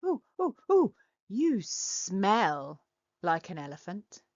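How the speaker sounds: usual speaking voice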